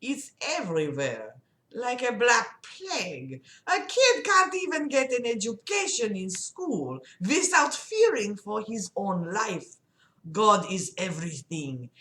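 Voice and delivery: slow, thick French-Russian guy voice; muddled French-Russian accent. Acted. Patient lecturing his doctor about God, slow French-Russian accent. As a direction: stretch words in a loose French-Russian accent. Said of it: "I need another guy voice".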